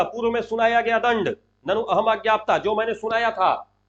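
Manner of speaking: acted stern telling-off; Duryodhana scolding. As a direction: rebuke a whole assembly in a ringing voice. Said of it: "डांटता है".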